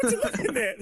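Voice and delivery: very high, loud, through laughter; high-pitched laughing jibe; laughing mock disbelief. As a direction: call out the player while cracking up. Mocking players who stare at their hand.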